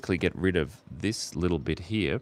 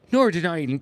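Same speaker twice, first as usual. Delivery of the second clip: high, hammed-up Northern Irish accent; bad Northern Irish accent; 9 semitones higher, 6 dB louder, faster than usual. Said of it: "I can't do the accent".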